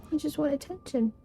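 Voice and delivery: high, soft pet talk; cooing to cat. Real, not acted. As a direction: tease the cat tenderly. Talking to the cat in arms.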